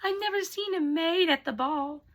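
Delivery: high, haughty stepsister laughing scornfully; mocking laughter. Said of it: "Cinderella's stepsisters laugh".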